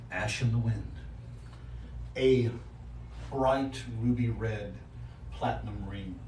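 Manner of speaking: game master's narration. Acted. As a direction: let it fade softly, with long pauses.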